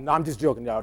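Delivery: normal talking voice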